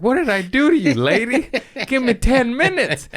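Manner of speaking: high-pitched, indignant plea; mock desperate protest. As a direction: plead in an exaggerated high voice. Retold protest to doctor before resetting.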